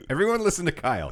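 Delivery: laughing, fast teasing. Real, not acted. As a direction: laugh while urging everyone.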